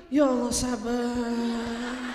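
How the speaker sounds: slow, drawn-out long-suffering sigh; slow weary prayer for patience; exasperated sigh for patience. Retold or imitated. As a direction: stretch it out like a worn-out mother. Mother sighing for patience.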